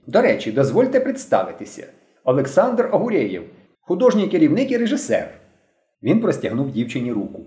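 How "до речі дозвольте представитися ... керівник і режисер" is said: mildly pompous, rising higher as it goes; a boy playing the dignified director; self-important introduction. A narrator's reading. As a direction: present yourself with grand formality. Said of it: "поважно промовив він".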